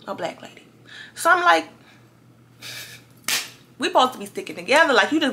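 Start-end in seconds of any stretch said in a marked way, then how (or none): 0.1-0.6 whispered aside. Real, not acted. Repeated in a hushed aside.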